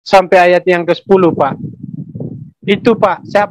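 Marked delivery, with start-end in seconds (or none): none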